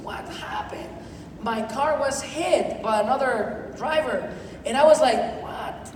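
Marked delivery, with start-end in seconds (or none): 0.0-0.9 unvoiced, hushed disbelief; stunned whisper. Retelling the moment his car was crashed, stunned whisper. 5.4-5.9 hushed, breath-only disbelief; stunned whisper. Retold or imitated. Retelling his reaction to the crash, stunned whisper.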